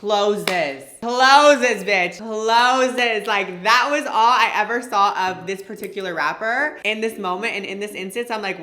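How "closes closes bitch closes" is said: loud rising emphatic repetition; dramatic emphasis. Real, not acted. Telling viewers how the car window shut on them, loud emphasis. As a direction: land each word harder, building.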